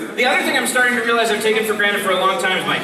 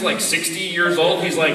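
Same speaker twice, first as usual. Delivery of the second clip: usual speaking voice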